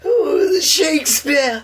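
very high, slow shrieked name; a hammed-up Iron Lady crying out; Margaret Thatcher. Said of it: "Then there's Margaret Thatcher".

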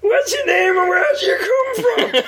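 very high, slow shouted question; a hammy TV host calling out. Retold or imitated.

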